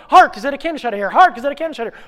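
high, loud, rapid rehearsal; frantic shouted line. Acted. Late actor rehearsing on the dash, frantic shout. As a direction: shout it on the run.

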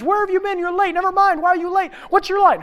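very high, loud rapid-fire questions; frantic scolding. Acted. Crew backstage to late actor, frantic scolding. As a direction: pounce on the latecomer in panic.

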